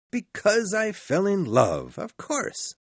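high-pitched sincere answer; earnest nephew. A narrator's reading. Nephew answers Scrooge's question.

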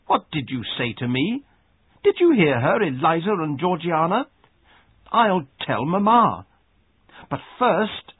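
high, loud bullying outburst; outraged cry. A narrator's reading. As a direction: rise from disbelief into a loud threat to tell. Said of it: "he cried".

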